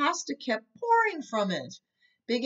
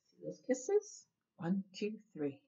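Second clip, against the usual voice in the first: much softer, hushed counting; soft aside to children; 2 semitones lower, 10 dB quieter than usual. A narrator's reading. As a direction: whisper it to the little listeners. Reader shows picture to children.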